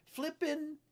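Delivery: "Flippin'" lifted high above the talk; stress on Flippin'. Real, not acted. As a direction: hit "Flippin'" high, as a mock expletive. Repeats the borrowed word for emphasis.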